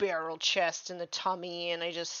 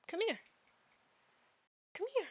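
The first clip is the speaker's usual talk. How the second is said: very high, sing-song call to a pet; calling the dog; 13 semitones higher, 6 dB quieter, faster than usual. Real, not acted. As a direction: coax the dog over in a very high voice.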